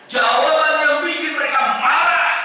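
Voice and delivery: high, rousing shout; emphatic preaching. Real, not acted. Preacher telling how the answer enraged them, emphatic shout.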